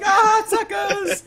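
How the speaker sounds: high, loud, drawn-out jeer; taunting mock shout. Asura jumps off ahead of the line, imitated gleeful taunt. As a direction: holler it while leaping away.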